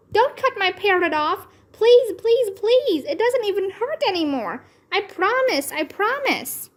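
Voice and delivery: high begging please; pleading. A narrator's reading. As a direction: beg her desperately not to cut it. Child begging mother.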